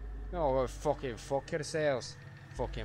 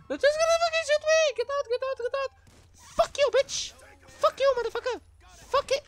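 high, loud screaming in panic; panicked scream; 8 dB louder than usual. Real, not acted. Streamer screaming as the police open fire, high panicked scream. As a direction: scream it at the top of your voice.

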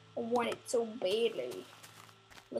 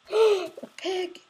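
gasp, then higher, louder exclamation; delighted gasp at a sudden find; excited surprise; 8 semitones higher, 6 dB louder than usual. Real, not acted. Spots a pig in game.

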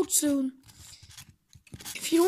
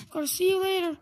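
normal talking voice

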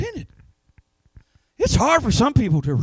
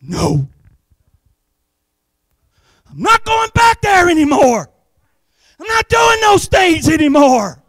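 defiant refusal; 5 semitones higher than usual. Retold or imitated. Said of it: "you're like".

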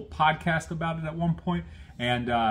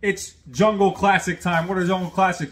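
segment announcer; 5 semitones higher, 6 dB louder than usual. Acted. Host announces his closing segment.

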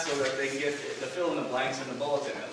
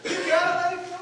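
mom cutting in; 13 semitones higher than usual. Retold or imitated. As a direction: play mom jumping into the fight, pitched high.

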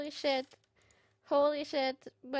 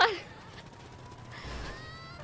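laugh; 12 semitones higher, 8 dB quieter than usual. Real, not acted. Overwhelmed by stream donation.

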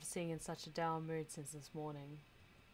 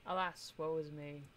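ordinary talk

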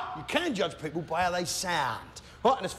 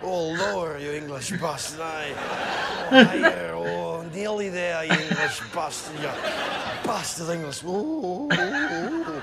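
moaning Scotsman; 3 semitones lower than usual. Acted. Comic mimicking a Scotsman enjoying a rub, moaning voice. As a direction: let the words melt into rising oohs. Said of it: "sounds like he's having his genitals rubbed with a warm, wet chamois leather".

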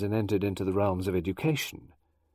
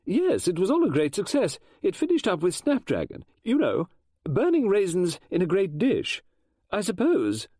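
higher, louder flustered lady novelist; Mrs Oliver voice; 9 semitones higher, 4 dB louder than usual. Acted. Mrs Oliver explaining schools to Poirot, high and eager. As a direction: chatter on like an excitable Englishwoman.

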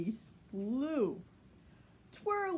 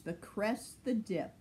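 ordinary delivery, nothing marked

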